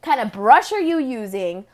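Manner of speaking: usual speaking voice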